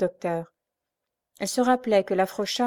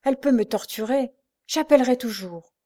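higher, louder defiant vow; suddenly exalted; 4 semitones higher, 5 dB louder than usual. A narrator's reading. Blind woman vowing to keep calling, exalted. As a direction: swell with sudden fervour. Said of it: "S'exaltant tout d'un coup".

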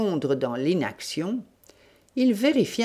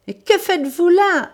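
high, loud angry demand; furious shout; 14 semitones higher, 7 dB louder than usual. Acted. Sholmès shaking Wilson by the shoulders, furious shout. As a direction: bark the question at him in rage. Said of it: "envahi d'une colère".

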